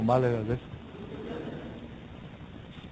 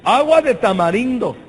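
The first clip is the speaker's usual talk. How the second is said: higher, louder, playful correction; amused insistence; 7 semitones higher, 6 dB louder than usual. Real, not acted. Preacher joking to the congregation, higher and louder. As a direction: sell the punchline. Joking about ear wax.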